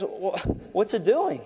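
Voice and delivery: a little worried. Retold or imitated. Boss asking the boy about the helicopter, mildly worried stammer. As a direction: imitate a mildly alarmed boss. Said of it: "getting a little worried".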